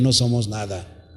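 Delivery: hushed reminder to the congregation that we are nothing; quiet. Shown as a demonstration. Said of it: "Escúcheme bien".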